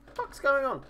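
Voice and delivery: high-pitched baffled self-quote; retold confusion. Streamer retelling his reaction to his flashing phone, mock-baffled. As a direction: act out being caught off guard, voice jumping up. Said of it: "and I was like".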